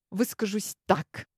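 usual speaking voice

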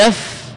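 يف cut off on a bare f, no vowel after; stopped with sukun. Shown as a demonstration. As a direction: end يف dead on the consonant f. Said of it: "بالسكون مع حذف الياء".

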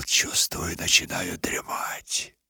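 drowsy whisper. A narrator's reading. Dozing off in the snow.

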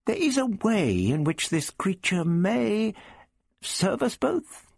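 put-on high, slow, pondering old man; musing. A narrator's reading. The Doctor thinks aloud to Prapillus, musing. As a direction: draw it out as the idea forms. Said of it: "he mused".

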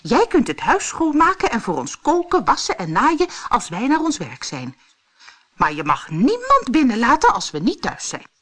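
high, fast, loud chorus of little men; dwarfs calling together. Acted. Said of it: "riepen de dwergen tegelijk".

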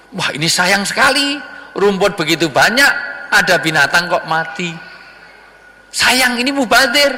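high, exaggerated pity; lamenting young man. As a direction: mourn the waste with a rising whine. Young man pities dead goat.

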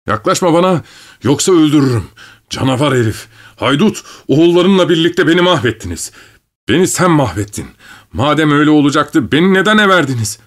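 high-pitched accusing tirade; heated warning turning to bitter reproach; angry threatening. A narrator's reading. Cornered man threatens his uncle.

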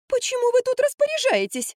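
very high, squealing protest; indignant. A narrator's reading. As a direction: object in an affronted whine. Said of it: "возмутилась Марфа". (возмущённый высокий визг)

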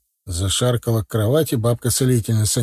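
plain reading voice

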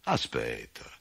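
low, drawn-out stalling; low grumbling farmer. A narrator's reading. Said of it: "rispose il contadino".

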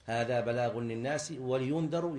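ولينذروا as "wa-li-yundharu"; corrected kasra. Shown as a demonstration. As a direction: give the lam of ولينذروا a clear short i. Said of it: "لا، هي بالكسر".

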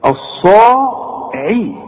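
الصاعي stretched slowly with a full heavy ص; drawn-out heavy emphatic saad. Shown as a demonstration. Teacher drawing out الصاعي for the student. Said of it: "شوف".

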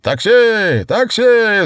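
high, loud bellow for a taxi; roaring shout. A narrator's reading. Osip hails a passing taxi with a roaring shout. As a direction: roar it across the square. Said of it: "заревел он".

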